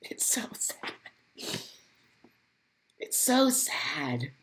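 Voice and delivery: breathy whisper of grief, slow; real sorrow, nearly whispered. Real, not acted. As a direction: barely voice it, heartbroken. Grief at tragedy.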